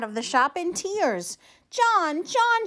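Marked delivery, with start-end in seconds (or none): 1.7-2.7 calling his name high and loud; a high, anxious call for John; searching for him, calling out. Acted. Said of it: "she cried".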